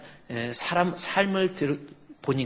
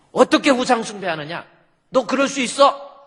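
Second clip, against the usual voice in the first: loud, high rebuke in the prophet's role; 호통치는 책망; 8 semitones higher, 8 dB louder than usual. Acted. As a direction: scold the people, voice raised and pitched up. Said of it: "말씀을 통해서 책망하는 거예요". (높고 큰 소리로 꾸짖듯)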